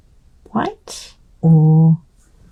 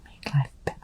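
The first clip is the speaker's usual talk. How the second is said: murmured under breath; whispering; 13 dB quieter, faster than usual. Real, not acted. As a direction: whisper it to yourself. Whispers sentiment to self.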